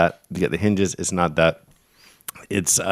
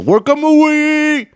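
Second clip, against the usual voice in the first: long drawn-out war yell, much higher and louder; warrior's cry; 8 dB louder, much slower than usual. Acted. Cohost invents a warrior cry for the made-up word, yelling it slowly. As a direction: bellow it slowly across a field. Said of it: "they yell".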